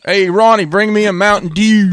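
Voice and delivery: high, loud call to Ronnie; mock Maryland local calling out.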